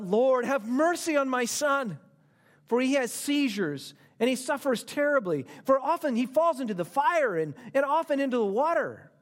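desperate father's plea. Acted. Preacher voicing the father begging Jesus for his son, pleading. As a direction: cry out for mercy for a sick son.

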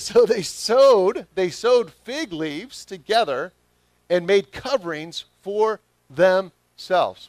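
loud, slow, high preaching of the verse; emphatic scripture reading. Acted. Preacher booming the verse to his congregation, loud and slow. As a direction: drag out each word with full force.

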